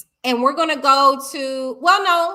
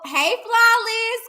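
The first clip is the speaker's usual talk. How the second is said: high drawn-out greeting; 11 semitones higher, 4 dB louder, much slower than usual. Real, not acted. Greeting a chatter by name.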